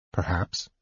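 low, soft, reluctant mutter. A narrator's reading. Uneasy knight mutters a reluctant answer to Laurana, soft. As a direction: mumble it as if you'd rather not answer. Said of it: "he muttered".

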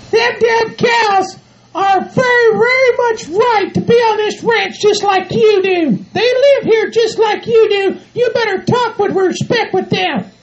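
high, slow falsetto lecture; indignant bossy partner. Acted. Bride telling groom to respect the cows, indignant. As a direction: defend them indignantly in a high put-on voice.

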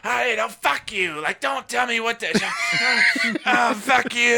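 high-pitched, hammed-up angry outburst; mock furious manager. Manager refusing orders from a coworker, mock furious.

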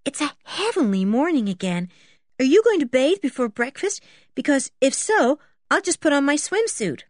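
put-on girl calling brightly to her friend; lightly voiced girl. A narrator's reading. Girl asking her friend about a morning swim, lightly voiced. Child voice in Famous Five story.

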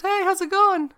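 very high, loud imitated hello; hammed-up suitor greeting; chirpy mock greeting. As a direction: put on an over-eager high "hey". Voices Paul chatting her up.

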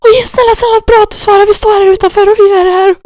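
high, loud, crying plea; tearful pleading. Retold or imitated. Begging the callee to let them in, high tearful plea. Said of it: "gråtandes".

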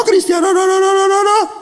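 high, hammed-up squeal of no; mock denial. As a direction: deny it in a high silly voice. Mocking denial of faith.

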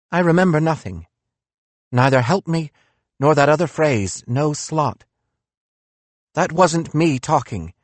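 Nietzsche voice. Acted.

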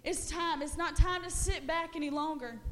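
loud, rising preacher's call to action; full-force preaching. Acted. Preacher rallying the church to action, shouting over music. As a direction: whip the church up, building.

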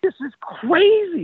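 high and slow, in disbelief; amazed. Retold or imitated. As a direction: draw out crazy in wonder. His own amazement, retold.

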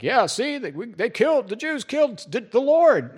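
mock Hitler voice. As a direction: sneer it like a hateful bigot. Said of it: "Hitler's like".

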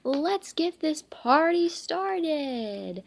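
slow, high showman call; lightly voiced party announcer; announcer kick-off. A narrator's reading. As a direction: hype up the crowd. Bowling pin announcer opens party.

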